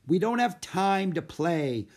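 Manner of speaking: put-on young Jack, objecting; boy's voice.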